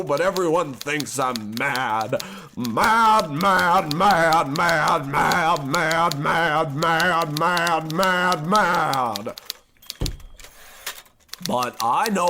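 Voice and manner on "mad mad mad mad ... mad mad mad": loud, rising rant of mad. Acted. Narrator insists he is not mad, raving. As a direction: rant the word over and over. Repeated ranting, louder.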